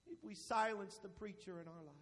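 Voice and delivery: sudden soft, low drop; low, subdued pulpit close; hushed preaching. Real, not acted. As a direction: let the rebuke fall quietly. Drops voice after climax.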